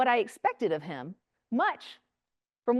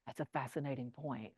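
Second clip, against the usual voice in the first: hushed polite reply; 11 semitones lower, 16 dB quieter than usual. Retold or imitated. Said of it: "They're like".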